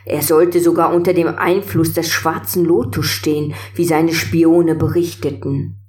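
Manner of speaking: plain reading voice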